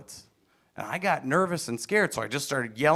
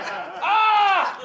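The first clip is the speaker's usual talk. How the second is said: very high yelp of fear and pain; high pained shriek; scared kid's scream; 3 dB louder than usual. Retold or imitated. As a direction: squeal like a frightened little boy. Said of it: "screaming out of fear and pain".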